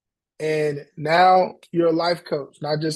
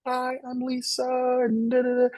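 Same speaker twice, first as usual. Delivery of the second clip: higher, soft, prissy "Hi, I'm Lisa"; mock prim intro; 7 semitones higher, 4 dB quieter than usual. Coach mocking the client's overly proper intro, prissy. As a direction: play the stiff, polished professional. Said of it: "try to be so".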